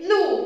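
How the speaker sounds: لو said as a brisk loud "lu"; short, punched لو; short vowel. Shown as a demonstration. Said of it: "بسرعة كده".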